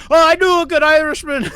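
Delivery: high, loud, quick mock-Irish cry; over-excited stage-Irish villager; hammed-up Irish. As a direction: burst out in cartoon Irish. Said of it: "they're just like".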